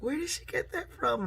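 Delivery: high-pitched mock disbelief; incredulous question. Amused viewers asking about the daughter's joke, high-pitched incredulous imitation. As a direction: ask it high, as a baffled onlooker.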